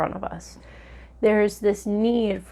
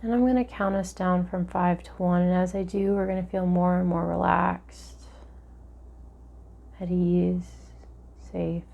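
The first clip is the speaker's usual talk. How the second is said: guided meditation; 4 dB quieter than usual. Acted.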